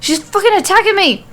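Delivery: shrill, high scream of panic; panicked scream. A narrator's reading. Nikki screaming at Alexa while attacked, panicked. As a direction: scream it as the bird claws your face.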